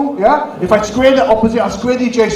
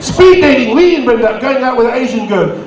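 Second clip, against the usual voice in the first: normal talking voice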